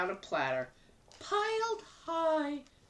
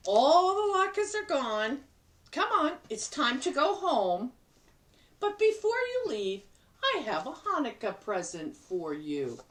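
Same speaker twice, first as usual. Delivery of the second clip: higher, cheerful grandmother voice; grandma Bubba; 8 semitones higher than usual. Acted. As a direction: play the kindly grandma talking to her guest. Bubba speaks to bear.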